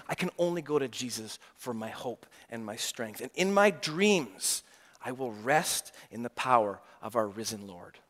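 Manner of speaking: soft, low faithful prayer; gently resolved; a suffering believer quietly trusting Jesus. Pretend.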